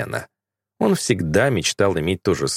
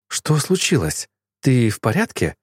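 mildly worried, higher-pitched questions; 4 semitones higher than usual. A narrator's reading. Woman alarmed for the man she loves, worried. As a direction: ask with gentle, anxious concern. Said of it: "забеспокоилась Эви".

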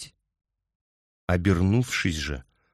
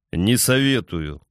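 plain reading voice

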